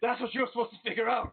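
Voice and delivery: high-pitched, exasperated master scolding a servant; exasperated outburst. Acted. Master berates servant after smack.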